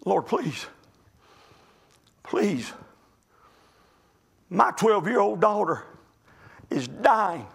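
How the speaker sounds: soft, broken begging to the Lord; father praying for his dying daughter, hushed plea; quiet pleading prayer. Retold or imitated. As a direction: hush down and plead like a desperate father. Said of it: "He's pleading".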